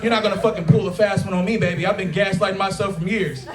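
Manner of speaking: normal talking voice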